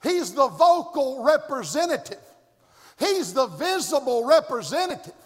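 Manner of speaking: loud, high, hammering preacher's cry; preaching at full force. Acted. Preacher shouting at the congregation about the cave. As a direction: preach it hard, driving each line home.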